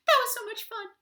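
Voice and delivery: very high gleeful squeak; delighted tiny toad. Acted. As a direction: squeal with fun.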